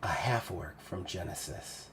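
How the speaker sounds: low, soft musing to herself; hushed realization. Acted. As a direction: murmur it as it sinks in. Siggy absorbs the revelation.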